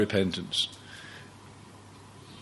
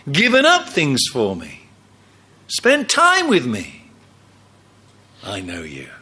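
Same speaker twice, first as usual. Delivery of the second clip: lightly voiced, tender recognition; Jesus welcoming. Jesus welcoming a faithful believer, a touch of tenderness. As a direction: greet them like an old friend.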